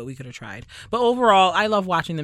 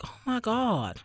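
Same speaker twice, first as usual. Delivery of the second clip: mock excitement; 7 semitones higher, slower than usual. Imagined Barbadians excited over royals.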